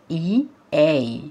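"اي اي" spelled slowly, letter by letter. Shown as a demonstration. Teacher spelling the missing letters slowly for pupils. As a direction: drag out each letter of "اي اي". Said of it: "ال two letters اللي ناقصين هنا".

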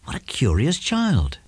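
ordinary delivery, nothing marked

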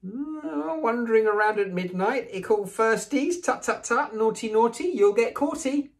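high, sing-song taunting spook; cackling poltergeist. Acted. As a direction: tease the children with glee. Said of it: "Peeves cackled".

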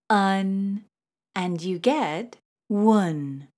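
normal talking voice

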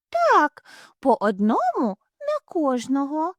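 high, excited little bunny; a tiny child bunny piping up. Acted. Little hare answers the cashier, high and eager.

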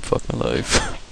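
ordinary talk

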